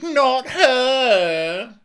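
very high, loud, drawn-out mock wail; hammed-up childish protest; imitating Tom. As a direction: stretch it into a squealed objection. Acting Tom's whining protest.

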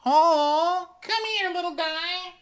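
very high cooing; high baby-talk cooing at a sad man. Pretend. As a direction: coo at him like a puppy. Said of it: "where you're like".

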